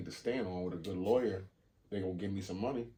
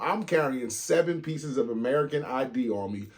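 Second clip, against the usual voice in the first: usual speaking voice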